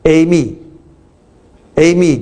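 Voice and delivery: Amy said very slowly, drawn out as the Greek eimi; Amy stretched long like a teaching demo. Shown as a demonstration. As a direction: spell out Amy syllable by syllable, very slow. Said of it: "应该可以说".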